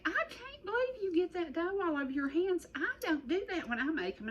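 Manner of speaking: high, put-on old granny voice; granny's high, fussing voice scolding the grandchild. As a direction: fuss like granny did over messy hands. Voicing granny scolding her.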